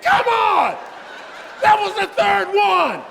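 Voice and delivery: high loud exasperated yell; frustrated shout. Retold or imitated. Patient yelling at the nurse after being turned down, high and loud. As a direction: protest loudly, hammed up.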